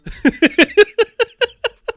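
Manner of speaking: a sudden high burst of disbelieving laughter; real laugh at the absurd plot twist; incredulous laugh. Real, not acted. Reviewer laughs in disbelief at the Waynes' deaths, a high burst of laughter.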